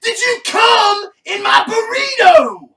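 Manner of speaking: high, slow, outraged yell; furious yell. Retold or imitated. Quoting an It's Always Sunny scene, a furious outraged yell. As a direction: bellow it like a wronged roommate.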